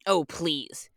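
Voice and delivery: usual speaking voice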